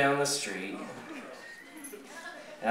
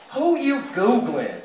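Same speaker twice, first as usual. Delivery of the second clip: higher, drawn-out front desk lady; 7 semitones higher, slower than usual. Acted. Desk lady calling to someone across the lobby, higher and slow. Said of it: "the lady at the front desk go".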